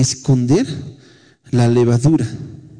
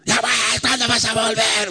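gentle plea; 8 semitones higher, 4 dB quieter than usual. Retold or imitated. Mother gently asks son.

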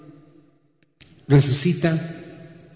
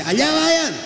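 calling out high; 13 semitones higher, slower than usual. Acted. Lord calls out from shore.

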